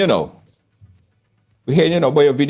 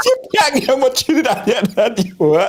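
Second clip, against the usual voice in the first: laughing; 9 semitones higher than usual. Real, not acted.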